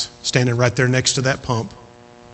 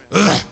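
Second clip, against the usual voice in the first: short, effortful "ugh"; imitated grandma's grunt; 4 semitones higher, 3 dB louder than usual. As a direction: mimic an exasperated grunt of trouble. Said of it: "she sounded like my grandma when she went".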